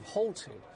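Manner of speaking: halted said slowly, each syllable leaned on; stress on halted. Real, not acted. Correspondent stressing halted to the anchor, slow weighted word. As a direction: lean hard on the word halted. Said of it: "I want to emphasize that word halted".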